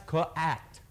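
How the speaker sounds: "cat" split into two sounds, kuh-at; sounded out. Shown as a demonstration. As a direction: sound out "cat" slowly like a teacher. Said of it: "this is how she'll do it".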